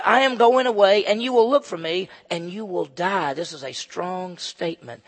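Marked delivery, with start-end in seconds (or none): 0.0-3.3 Jesus' words proclaimed. Acted. Pastor voicing Jesus to the religious leaders, solemn and loud. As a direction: declare it like a prophecy.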